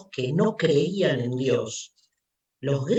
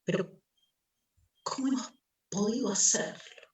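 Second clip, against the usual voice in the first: anguished madman; 5 semitones higher than usual. Acted. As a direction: ask it as if the ground is gone.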